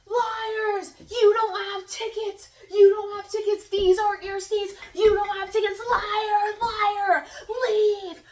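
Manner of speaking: high, loud accusing shouts; imitating an outraged woman yelling, each cry sustained. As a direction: yell at them to get out. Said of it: "She just starts yelling at us".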